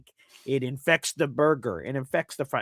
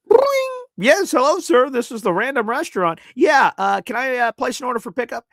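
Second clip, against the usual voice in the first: high, hammed-up polite phone voice; a put-on high voice ordering takeout; mock phone caller; 9 semitones higher, 7 dB louder than usual. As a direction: play an overly polite caller. Phoning a restaurant.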